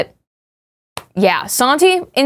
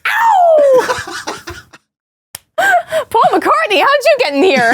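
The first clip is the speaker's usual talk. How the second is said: rock singer yelp; much slower than usual. Pretend.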